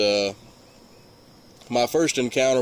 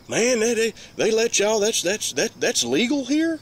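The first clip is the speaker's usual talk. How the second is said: put-on high, astonished kid's voice, much higher; amazed kids; 11 semitones higher than usual. Teenage kids staring at the gun, asking in high amazed disbelief. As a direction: squeak out the question in disbelief.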